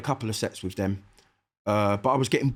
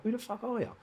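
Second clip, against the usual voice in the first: incredulous; 11 semitones higher, 6 dB quieter than usual. Retold or imitated.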